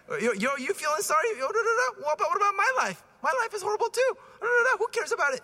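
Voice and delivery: high, pushy mock whine; mock the husband who only cares about himself; hammed-up self-pitying husband; whiny husband. Priest mimics self-pitying husband.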